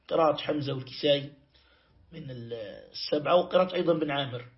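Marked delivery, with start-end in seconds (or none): none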